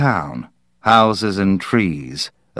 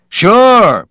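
much higher, loud cheerful yell; brash shout; 13 semitones higher, 9 dB louder than usual. Acted. Parkhurst answering Leon's teasing with a loud cheerful yell. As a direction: shout it out gleefully.

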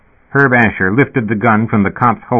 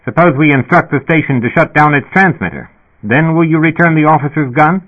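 higher-pitched negotiating dispatcher; sputtering intercom speaker; 7 semitones higher, 3 dB louder than usual. A narrator's reading. Dispatcher bargaining for the officer's gun, sputtering. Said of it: "The speaker sputtered".

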